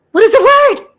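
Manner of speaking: high, loud pleading cry; frustrated call to Samuel for help; exasperated shout. Real, not acted.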